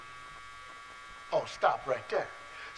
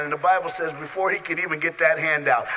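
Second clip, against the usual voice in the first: ordinary talk, nothing marked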